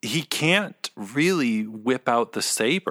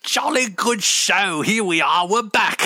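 put-on British accent; 6 semitones higher, 3 dB louder than usual. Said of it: "with the British accents".

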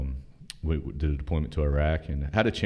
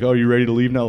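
mocking instructor; 8 semitones higher, 5 dB louder than usual. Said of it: "same dude was like".

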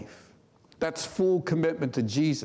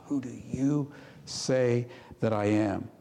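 whisper; 2 semitones lower than usual. Shown as a demonstration. Personal question after not mom, not dad.

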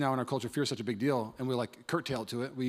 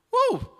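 excited whoop; 7 dB louder than usual. Real, not acted. Preacher's excited shout.

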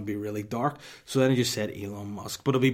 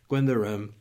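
usual speaking voice

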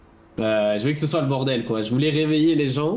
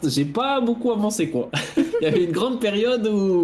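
laughing; 7 semitones higher than usual. Real, not acted. Laughing at own slacking off.

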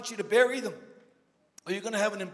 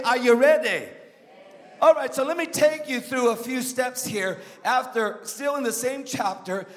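ordinary talk, nothing marked

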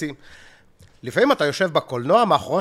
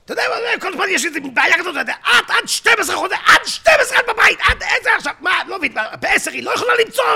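much higher, loud shouting rant; Haim Elmakis; 5 dB louder than usual. Retold or imitated. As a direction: shriek the complaint in a high loud voice. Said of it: "חיים אלמקיס".

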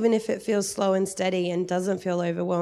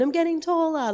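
put-on child's chirpy line, pitched much higher; mock cheerful child; 9 semitones higher than usual. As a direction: play a happy kid, voice jumping up. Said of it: "Poppy doesn't lie there going".

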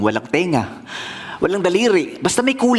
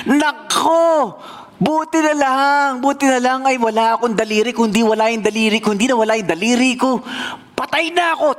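excited relief; 6 semitones higher than usual. A narrator's reading. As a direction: blurt out the lucky escape. King recounts near death, relieved. (ginhawang bulalas)

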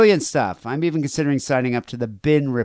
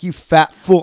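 sudden loud, higher mock outburst; mock insult; 6 semitones higher, 10 dB louder, slower than usual. Co-host acts out calling the man fat, mock outburst. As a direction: play at hurling an insult.

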